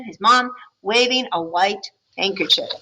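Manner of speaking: ordinary delivery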